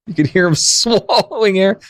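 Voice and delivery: high-pitched, laughing through the words; amused, laughing. Real, not acted. Streamer arguing it was not a burp, amused laughing. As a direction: break into a real laugh midway.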